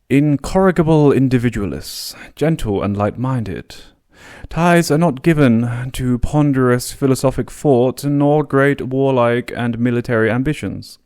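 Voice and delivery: a hint of plummy British colonial officer reading the report; mock posh English accent. Shown as a demonstration. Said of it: "I'm doing a very English accent here".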